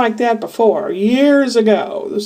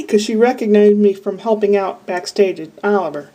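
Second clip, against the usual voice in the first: usual speaking voice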